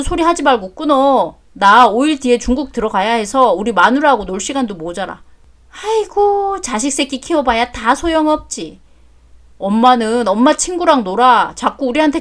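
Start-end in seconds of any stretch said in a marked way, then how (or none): 5.8-6.6 high wailing aigo of lament; a hammed-up sigh of despair. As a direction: cry out in self-pity. Mother laments ungrateful son.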